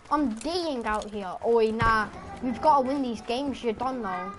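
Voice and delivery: normal talking voice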